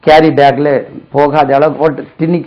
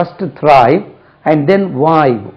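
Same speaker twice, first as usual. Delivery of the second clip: First thrive and then wife, slow with a stress on then; teacher stressing a rhyming proverb; much slower than usual. Shown as a demonstration. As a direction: spell out the saying slowly for students. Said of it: "அது உள்ளே wife சொல்றாங்க".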